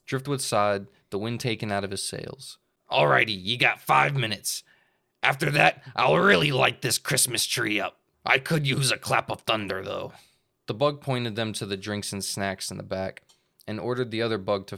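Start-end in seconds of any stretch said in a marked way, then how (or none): 2.9-10.1 louder pirate captain. Acted. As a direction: growl out the threat. Pirate speaking.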